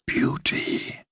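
eerie breathy whisper from the willows. A narrator's reading. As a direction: hush it out like wind in the leaves. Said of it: "whispered the willows".

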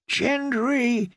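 high, soft wheeze of a name; strained wheezed call; wheezing. A narrator's reading. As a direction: force the name out through a sore chest. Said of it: "she wheezed".

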